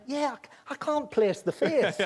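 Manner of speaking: put-on high old-lady voice through a laugh; lightly laughing old lady; old woman voice. Old woman peering at the slashed clown, high and laughing. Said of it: "And she went".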